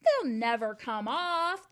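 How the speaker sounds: loud, slow, dismissive retort; shouted back. A narrator's reading. Trunchbull shouts back at Miss Honey, dismissive. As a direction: fling it back across the room. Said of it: "the Trunchbull shouted back".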